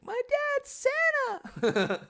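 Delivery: put-on very high, loud kid voice; kid shouting. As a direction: shout it like a defiant little boy. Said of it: "And he's like".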